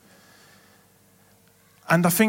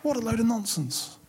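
scoffing; 6 semitones higher, 5 dB quieter than usual. Real, not acted. Scoffing at the devil.

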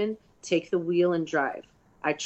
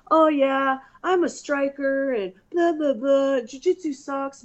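mocking cocky younger self; 8 semitones higher, slower than usual. Mocking her old cocky self.

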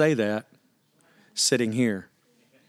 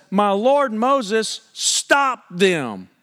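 higher, drawn-out pleading address; urgent Joshua; 7 semitones higher, much slower than usual. Retold or imitated. Joshua urging Moses to stop the prophets, pleading. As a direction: beg Moses to intervene. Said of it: "he comes up to him and he says".

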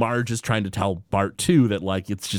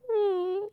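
sad dog whimper; 5 dB quieter than usual. Retold or imitated. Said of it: "And the dog's like".

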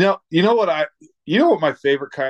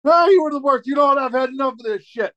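high, loud mock dad explosion; a dad who has had enough, yelling high; angry dad outburst; 11 semitones higher, 4 dB louder than usual. Retelling a dad losing it, hammed-up high yell.

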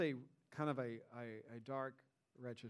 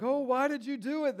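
loud, high exasperated groan; mad groan; 9 semitones higher, 7 dB louder than usual. Acted. Frightened sailors to Jonah who caused the storm, angry groan. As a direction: groan it at him, raised and upset. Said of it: "they groaned. Like, they're mad".

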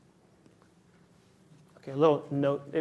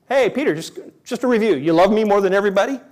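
higher, louder playful nudge, fast at the end; a teasing just-checking tone; playful Jesus asking Peter again; teasing repeat; 5 semitones higher, 8 dB louder, faster than usual. Retold or imitated.

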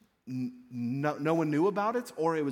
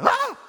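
very high, loud panicked scream; demon's alarmed cry; freaking-out shriek; 10 dB louder than usual. Acted. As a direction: shriek in sudden terror. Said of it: "this demon is freaking out".

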